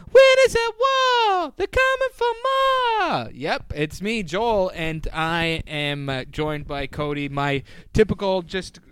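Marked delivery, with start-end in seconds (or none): 0.1-3.3 much higher, slow, strained promo tagline; hammed-up trailer voice. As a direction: ham up the season tagline like a cartoon announcer.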